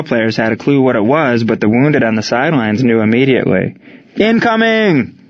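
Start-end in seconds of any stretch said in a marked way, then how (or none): 4.2-5.2 high, drawn-out warning cry; urgent high-pitched call; screamed warning. A narrator's reading. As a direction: stretch it out high, like a soldier warning everyone. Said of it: "screamed one of the soldiers".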